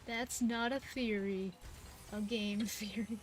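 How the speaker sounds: host catchphrase impression. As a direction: draw out the catchphrase quietly. Imitating a YouTube host's catchphrase.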